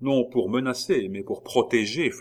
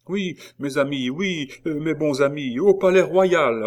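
higher-pitched, agitated pleading; dragged man echoing the crowd; 7 semitones higher, 6 dB louder than usual. A narrator's reading. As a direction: humour the mob, rising to a loud cry. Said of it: "répétait cet homme".

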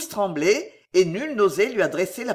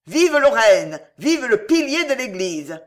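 much louder frantic crowd yell; frenzied shouting; 4 semitones higher, 7 dB louder than usual. A narrator's reading. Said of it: "vociférèrent avec frénésie les ligueurs".

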